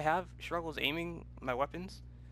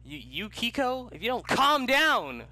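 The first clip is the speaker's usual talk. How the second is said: high, loud, slow scolding; hammed-up angry warning; mock yelling threat; 9 semitones higher, 6 dB louder than usual. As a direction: yell it like a fed-up parent. Yelling at companion.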